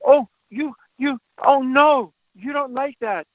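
high, exaggerated commiseration with a toddler; short, halting phrases mirroring a small child's upset; emotional toddlerese sympathy. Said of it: "What feels better is to say".

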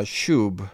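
shub said slowly as a Hebrew term; careful Hebrew term; Hebrew word shub. Shown as a demonstration. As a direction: give the Hebrew shub its own careful sound. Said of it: "This one is".

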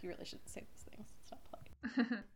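whispered prim scolding; prim daughter. Retold or imitated. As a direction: scold like a proper little lady, hushed. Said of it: "She's like".

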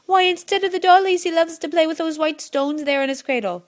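high, proud mother patter; beaming mother. A narrator's reading. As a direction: boast cheerily about the baby.

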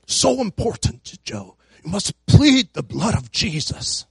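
put-on light South African accent; a hint of a South African friend. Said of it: "I don't have a good South African accent".

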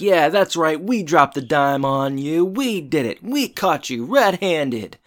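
high, drawn-out mocking gloat; taunting sing-song crowing; heckling. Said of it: "standing there heckling Crazy Luther".

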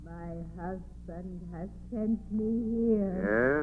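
slow, hushed, distant ghost; slow soft ghost voice; ghostly voice. Acted. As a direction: speak as a spirit from beyond. Dead wife's ghost speaking.

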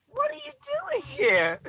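very high, slow mock amazement; astonished question. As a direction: draw it out in a high, disbelieving voice. [name] astonished to see her.

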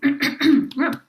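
loud, sharp throat clearing. Real, not acted. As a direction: clear your throat hard. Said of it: "Losing my voice there for a second".

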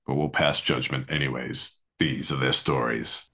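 low, measured announcer voiceover; mock courtroom show intro. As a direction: intone it like a crime drama opening, deep and deliberate.

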